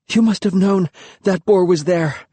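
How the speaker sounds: out of breath; gasping. A narrator's reading. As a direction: gasp it out after running. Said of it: "I gasped".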